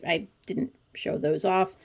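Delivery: usual speaking voice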